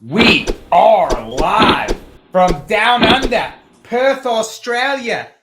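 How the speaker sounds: loud, very slow, drawn-out ring announcer call; announcer intro. Acted. Podcast host opening the live show from Perth, big announcer voice. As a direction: boom it out like a fight-night announcer.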